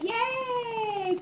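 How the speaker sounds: high bright yay; sudden high-pitched yay; excited cheer. Real, not acted. Cheering baby on.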